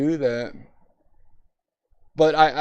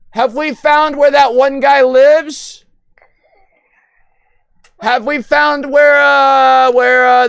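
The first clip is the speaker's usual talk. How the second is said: raised, high voice; loud calling out; 7 semitones higher, 8 dB louder, slower than usual. Real, not acted. Streamer asks a chat regular, loud calling out. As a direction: keep hollering the question out. Loud question to returning chatter.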